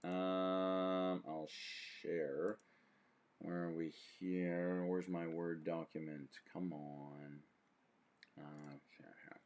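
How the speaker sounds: quiet, low muttering aside; muttering to himself. Real, not acted. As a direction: mumble while fiddling with screen. Muttering to self at computer.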